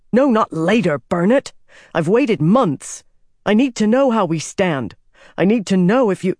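impatient, forceful plea; frustrated insistence. Acted. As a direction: push back, demanding an answer. Pleading demand after being put off.

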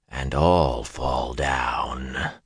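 slow, low, unvoiced rasping whisper; soft Fade whisper. A narrator's reading. The Myrddraal taunting Perrin, a slow rasping whisper. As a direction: whisper like dry rotted leather crumbling. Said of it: "it said softly".

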